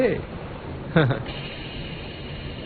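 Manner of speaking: quiet airy chuckle; a short soft laugh out of breath; soft breathy laugh. Acted. Soft laugh after the invitation.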